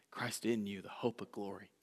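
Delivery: softly, almost breathed; hushed. Real, not acted. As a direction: drop to a near whisper, soft and low. Hushed turn to Christ within.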